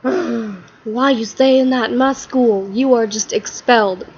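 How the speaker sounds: slow, put-on voice of outrage; hammed-up indignant expulsion; outraged principal. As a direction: scold slowly as a scandalized principal. Outraged principal expels Todd.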